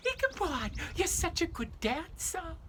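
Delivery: Katrina's voice. Retold or imitated. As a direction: play the coy belle praising her partner. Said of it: "and she says".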